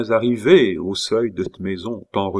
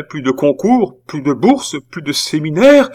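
high, loud lament, mounting item by item; sobs building to howls; 8 semitones higher, 7 dB louder than usual. A narrator's reading. Aunt listing the nephew's lost prospects amid his sobs, mounting wail. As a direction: wail each loss harder than the last. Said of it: "se changèrent en hurlements".

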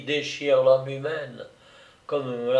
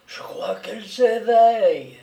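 lightly voiced old woman, hushed; a hint of an old woman whispering; whispered old woman; 7 semitones higher, 5 dB louder than usual. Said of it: "et chuchota".

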